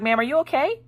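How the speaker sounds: high, alarmed nurse voice; startled nurse. Retold or imitated. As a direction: nurse checking on a mother who yelled. Startled nurse checking on mother.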